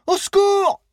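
very high, loud cry for help; shout for help. A narrator's reading. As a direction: scream for rescue into the dark. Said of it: "Maddy continua pourtant de crier". (appel au secours crié, très aigu et fort)

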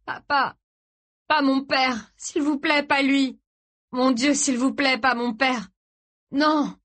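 high, anguished begging; sobbing plea. A narrator's reading. Son begging God for his father's life, sobbing. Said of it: "sanglota Gray".

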